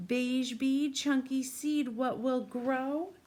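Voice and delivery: put-on slow, sing-song rhyme for a child; riddle read-aloud. A narrator's reading. Teacher reads the rhyme to a young child, slow sing-song. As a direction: chant the riddle slowly to a little listener.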